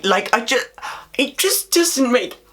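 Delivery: strained, fraying voice from ranting; losing voice. Real, not acted. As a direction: voice wearing thin, pushed high. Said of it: "I'm, like, losing my voice".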